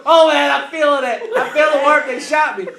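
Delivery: mock-panicked victim; imitated wounded wail. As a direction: wail like a man sure he's hit. Wounded cousin wailing in the story.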